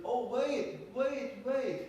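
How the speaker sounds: high sudden realisation; realising. Acted. Schwabians suddenly understanding, high and startled. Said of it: "Schwabes understood".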